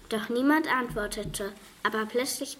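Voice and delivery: ordinary delivery, nothing marked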